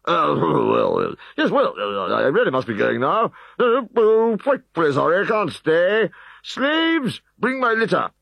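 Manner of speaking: high, loud flustered excuses; flustered prefect. Acted. Prefect Odious Asparagus escaping reprisals, high and flustered. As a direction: stammer and hurry away.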